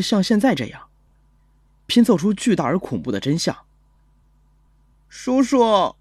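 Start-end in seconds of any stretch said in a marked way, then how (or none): 5.1-5.9 put-on timid little boy, higher pitched; a child's small, high call to an adult; young boy. Little boy phoning the police for help, young boy voice. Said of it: "听声音不过十岁左右".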